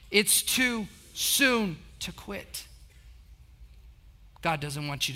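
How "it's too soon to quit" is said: "too soon to quit" drawn out word by word; slow call to join. Shown as a demonstration. Preacher leading the congregation to say it together, slow and spaced. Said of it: "Say it with me".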